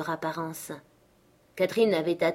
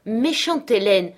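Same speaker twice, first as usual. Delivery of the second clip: slow, loud, higher mock scolding; playful exclamation; 4 semitones higher, 7 dB louder, slower than usual. A narrator's reading. Catherine showering her nurse with caresses, playful scolding cry. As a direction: cry out in affectionate reproach. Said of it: "s'écria Catherine".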